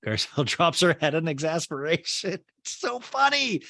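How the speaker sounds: usual speaking voice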